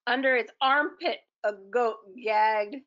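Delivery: ordinary delivery, nothing marked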